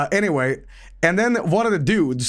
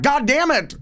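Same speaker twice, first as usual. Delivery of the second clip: frustrated outburst; 10 semitones higher, slower than usual. Retold or imitated. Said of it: "I'm like".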